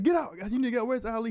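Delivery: high, urgent retold order; retold freaking out. Linebacker ordering freshman sub out, retold freak-out. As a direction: re-enact a panicked demand, pitch way up. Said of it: "I'm freaking out".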